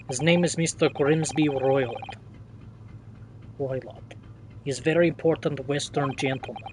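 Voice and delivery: lightly voiced Russian-accented sailor, proud; a hint of a boastful Russian seaman. Sailor showing off the first-class cabins, light Russian accent.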